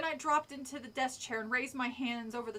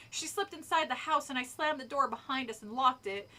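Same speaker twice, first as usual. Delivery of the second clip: plain reading voice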